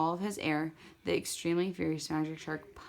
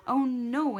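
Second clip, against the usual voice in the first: higher, louder piglets crying out; frightened cry; 7 semitones higher, 5 dB louder than usual. A narrator's reading. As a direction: squeal it in alarm. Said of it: "answered the little pigs".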